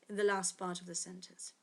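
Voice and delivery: ordinary talk, nothing marked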